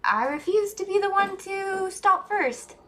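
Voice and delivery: high, hammed-up defiant vow; mock defiant. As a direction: squeal it like a stubborn kid.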